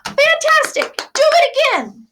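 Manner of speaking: high, loud gleeful shout. Acted. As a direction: cheer him on at the top of your voice. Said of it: "but Elizabeth shouted".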